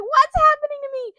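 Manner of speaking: very high, loud panicked shriek; Luffy. Retold or imitated. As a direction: cry out in cartoon panic. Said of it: "he's like".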